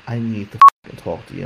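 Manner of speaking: loud, urgent demand in the friend's voice; forcing him to listen, loud and urgent; insistent retold yell.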